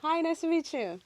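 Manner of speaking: high-pitched, sweetly polite hello; extra nice. Shown as a demonstration. Showing the co-host the nicest one can be, high nice greeting. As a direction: put on your nicest greeting voice. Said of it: "what's the nicest you can be".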